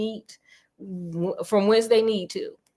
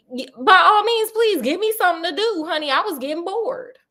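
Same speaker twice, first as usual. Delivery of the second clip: Sherlock; 7 semitones higher than usual. Retold or imitated. Bored Sherlock asking the visitor for a case, mock pleading. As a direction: play up the eager request for something to do. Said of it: "So he's just like".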